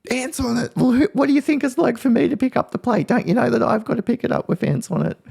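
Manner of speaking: hammed-up mum throwing his excuse back at him; exasperated mum. Mum snapping back at her son, mock exasperated and high. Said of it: "And I'm like".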